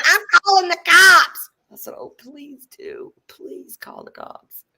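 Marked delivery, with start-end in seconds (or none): 0.1-1.5 high, loud shriek; landlady shrieking that she's calling the cops; shrieking threat. Retold or imitated. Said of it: "She's like". 2.0-4.6 slow, soft, sarcastic dare; taunting. Retold or imitated. Taunting landlady calmly.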